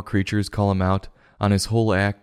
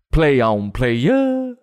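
hype cheer; 5 semitones higher, much slower than usual. Real, not acted. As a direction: squeal up high on playa. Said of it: "cheering the fish on".

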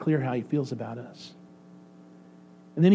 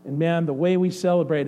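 usual speaking voice

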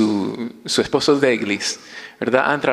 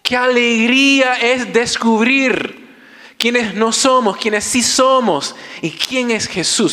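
impassioned preaching; 10 semitones higher than usual. Acted. Preacher proclaiming joy to the congregation, voice raised high.

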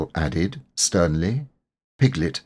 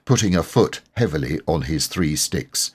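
ordinary delivery

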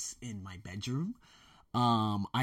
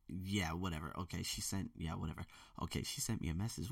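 muttering; 4 semitones lower, 12 dB quieter than usual. Real, not acted. Mumbling while searching phone.

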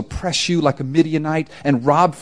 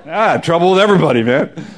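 laughing; faster than usual. Real, not acted. Laughing aside about sad fate.

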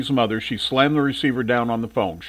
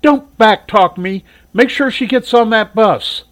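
louder, higher, slow warning; mother cuts off her son's complaint, louder and higher; scolding; 9 semitones higher, 6 dB louder, slower than usual. Acted. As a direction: snap back at the backtalk. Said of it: "Shirley said".

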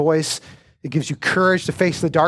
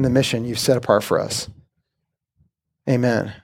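prayer. Real, not acted. Pastor leads prayer.

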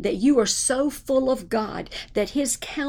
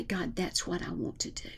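softer, unvoiced breathy whisper of a prayer; 2 semitones lower, 9 dB quieter than usual. Quoted prayer to God.

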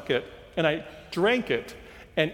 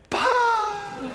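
teasing pah shout. Retold or imitated. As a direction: break the lull with the joke. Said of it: "Somebody would look up and go".

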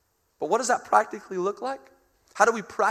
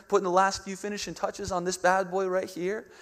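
normal talking voice

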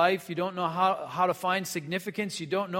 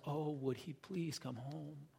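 slow, much softer wistful plea; longing plea; 12 dB quieter than usual. Retold or imitated. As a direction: sigh out the father's hope, slowly. Father's heart longing.

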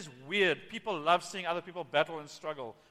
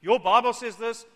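loud, insistent mock demand; commanding God; 5 semitones higher, 11 dB louder than usual. Imitating a believer giving God orders, loud and demanding. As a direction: open high and forceful, like issuing orders upward. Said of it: "I'm commanding God".

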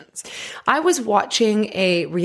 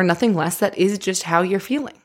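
usual speaking voice

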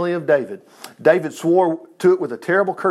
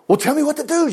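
mild plea with a higher start; begging; 8 semitones higher, faster than usual. A narrator's reading. Jonathan asking David what to do, mildly begging. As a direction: plead lightly, pitch raised. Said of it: "Jonathan begged".

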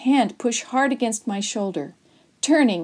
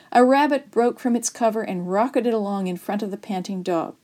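usual speaking voice

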